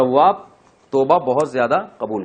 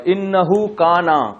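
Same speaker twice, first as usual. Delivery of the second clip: recitation; 5 semitones higher, much slower than usual. Shown as a demonstration. Recites the verse.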